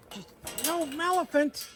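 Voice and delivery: high startled cry at the goat; alarmed cry. Real, not acted. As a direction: yelp out in alarm as the goat gets in. Goat climbs, he exclaims.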